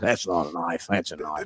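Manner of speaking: low, soft Australian movie quote; Crocodile Dundee. Retold or imitated. Comic quoting a film to show off, Australian accent. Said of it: "I watched fucking Crocodile Dundee".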